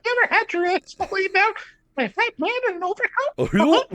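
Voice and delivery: very high cartoon falsetto; Mickey Mouse. Cartoon mouse reciting crude film dialogue, squeaky. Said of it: "Mickey Mouse with voice".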